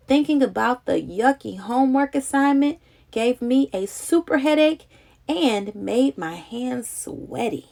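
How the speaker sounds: usual speaking voice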